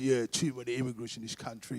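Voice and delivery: imitated geezer's complaint; grumbling geezer. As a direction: grumble it low like a pub geezer. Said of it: "like, geezers".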